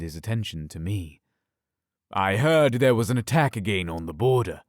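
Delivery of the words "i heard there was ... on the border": alpha voice. Acted. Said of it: "he asked in his alpha voice".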